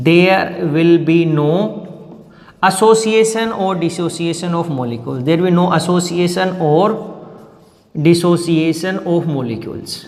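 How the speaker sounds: very slow, phrase by phrase; slow dictation. Shown as a demonstration. Teacher dictating the first limitation to students, slow. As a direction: spell out each word for notes.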